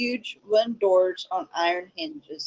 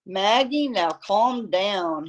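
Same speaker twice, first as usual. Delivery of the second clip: plain reading voice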